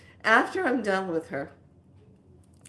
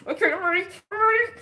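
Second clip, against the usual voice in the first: working up the nerve, climbing in pitch; psyching up; 9 semitones higher, faster than usual. Retold or imitated. Bracing herself for the massage, voice climbing. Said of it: "And I go".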